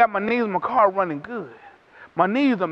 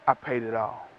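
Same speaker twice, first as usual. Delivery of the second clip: much lower and softer, solemn and steady; quiet pleading advocate; 14 semitones lower, 10 dB quieter than usual. Acted. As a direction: say it low like a final verdict of love. Advocate pleading before God.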